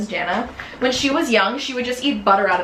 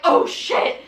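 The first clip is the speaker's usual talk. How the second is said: retold alarmed reaction; 7 semitones higher than usual. Retold group reaction to crash.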